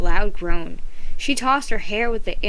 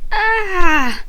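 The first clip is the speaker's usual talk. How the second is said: sharp high angry cry of frustration; a shrill yell of rage; angry scream; 10 semitones higher, 8 dB louder than usual. A narrator's reading. Girl screams at wrecked front page, angry scream. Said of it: "in an angry scream".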